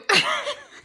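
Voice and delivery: sudden high-pitched giggle; high squealing laugh. Real, not acted. Laughs at her own slip.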